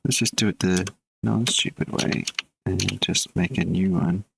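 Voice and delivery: ordinary talk, nothing marked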